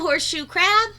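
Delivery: ordinary delivery, nothing marked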